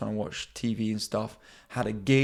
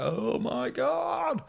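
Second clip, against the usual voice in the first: high high; 13 semitones higher, much slower than usual. Retold or imitated. Buzzing on MDMA, high drawn out.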